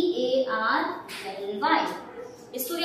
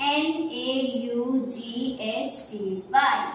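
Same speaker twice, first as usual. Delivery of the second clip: slow letter-by-letter spelling; 2 semitones lower, much slower than usual. Shown as a demonstration. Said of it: "अब next मैंने लिख दिया".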